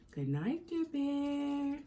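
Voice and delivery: soft goodnight. Acted. Narrator softly saying goodnight to the sleeping Bear. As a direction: say goodnight so Bear won't wake.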